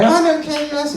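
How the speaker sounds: very high, loud dummy squeak; exaggerated high lady voice; feminine voice. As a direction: pipe up as the wife puppet. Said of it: "let's find a nice feminine voice".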